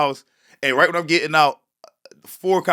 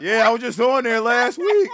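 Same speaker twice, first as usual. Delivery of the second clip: laughing; 8 semitones higher than usual. Retold or imitated. Laughing retold reply.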